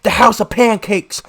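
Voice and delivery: high, slow, drawn-out holler; loud, insistent street yelling; screaming. Retold or imitated. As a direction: imitate a man screaming a demand. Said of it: "he's screaming at me".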